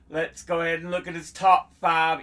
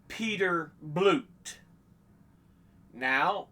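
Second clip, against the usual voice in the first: ordinary talk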